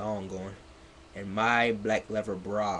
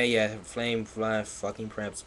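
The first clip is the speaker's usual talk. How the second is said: ordinary talk, nothing marked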